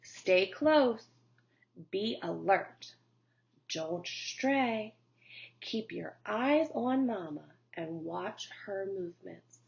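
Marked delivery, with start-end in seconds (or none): none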